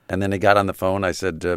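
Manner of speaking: ordinary delivery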